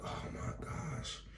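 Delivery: slow, breathy mock gasp of horror; hushed disbelief. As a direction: draw it out in a stunned whisper. Reacts aghast to retold scene.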